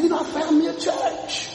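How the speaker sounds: high, pleased mock boast; preacher voices a person bragging to a friend, mock proud; mock proud churchgoer. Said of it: "You say".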